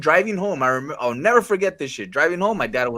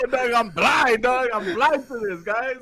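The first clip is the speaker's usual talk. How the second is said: laughing self-mockery; 10 semitones higher, slower than usual. Real, not acted. Laughing self-mockery after admission.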